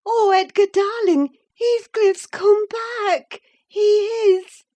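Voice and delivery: high, loud, slow ecstatic cry; overjoyed wife telling husband her friend returned; wild joy. Acted. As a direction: overflow with joy you can barely hold. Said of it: "breathless and wild, too excited".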